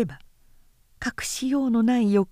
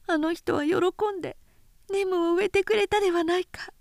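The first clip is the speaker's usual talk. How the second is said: put-on high, hushed young woman's voice, half whispered; piecing the truth together under her breath; Nao, shaken realisation; 9 semitones higher than usual. Nao alone in her room recalling her husband, high and hushed.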